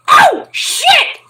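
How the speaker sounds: very high, loud squeal; mock girly shriek of amazement; excited girls. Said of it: "you get the girls that go".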